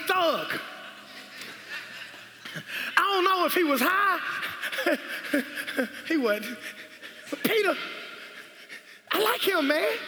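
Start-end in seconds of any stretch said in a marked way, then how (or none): 4.2-6.0 chuckle. Real, not acted. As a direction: chuckle at your own joke. Laughing at own joke.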